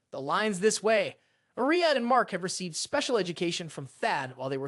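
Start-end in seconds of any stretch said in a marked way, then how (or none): none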